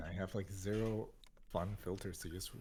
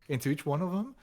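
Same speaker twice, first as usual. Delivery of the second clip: roaring into bucket; 8 semitones higher, 7 dB louder, faster than usual. Retold or imitated. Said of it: "I was like".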